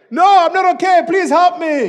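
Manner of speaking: high, desperate shouted plea; loud, high cry for rescue; screaming for help. Acted. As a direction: yell back in panic from a cliff. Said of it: "Jack was screaming".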